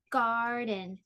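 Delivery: "Garden" stretched over two beats; drawn-out syllables in "Garden"; split into syllables. Shown as a demonstration. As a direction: say "Garden" as gar, den. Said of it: "divide words into syllables".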